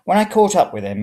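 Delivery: usual speaking voice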